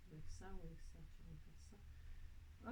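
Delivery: aparté chuchoté, lent, pour soi. Real, not acted. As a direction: souffle les mots pour toi, très lentement. Aparté en choisissant la couleur. (chuchoté tout bas, lentement)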